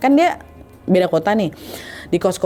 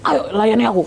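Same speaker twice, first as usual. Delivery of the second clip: rough demand; 2 semitones higher than usual. Acted. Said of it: "genggaman tangannya kasar".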